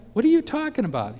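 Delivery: high, loud and fast alarmed disbelief; alarmed question. Retold or imitated. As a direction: blurt it out in shock, high and quick. Alarmed at cousin's news.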